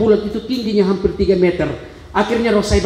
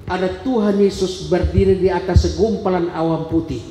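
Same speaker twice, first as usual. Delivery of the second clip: ordinary talk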